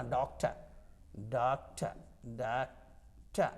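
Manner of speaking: "डॉक्टर" said with the final r barely sounded; soft final r. Shown as a demonstration. Teacher repeats doctor for students with a light, nearly silent r. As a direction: let the r of "डॉक्टर" almost vanish, the last one drawn out slowly. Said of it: "र को हल्का बोलना है".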